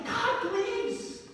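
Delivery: high, strained begging cry; pleading cry. Retold or imitated. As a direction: plead like a desperate woman. Acting Hannah's pleading prayer.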